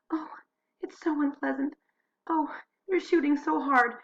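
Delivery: high, timid whimper, almost crying; a frightened woman's high thin plea; terrified neighbour voiced high and trembling; timid, near tears. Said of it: "nearly crying and said in a very timid voice".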